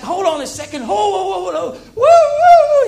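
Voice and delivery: high, loud hammed-up calling out; Satan calling out. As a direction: play the devil shouting to stop you. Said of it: "'cause he's like".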